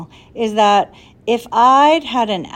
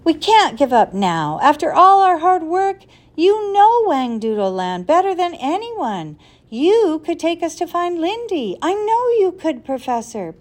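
pleading; 9 semitones higher, 3 dB louder than usual. Acted. Ben begging the professor to help find Lindy, high loud pleading. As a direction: beg with everything you have. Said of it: "Ben pleaded".

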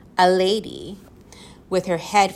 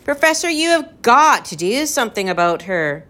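higher, insistent plea; imploring; 6 semitones higher, 4 dB louder than usual. A narrator's reading. Tom begs the professor to remove the bird, imploring. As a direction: beg with rising exasperation. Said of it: "Tom implored".

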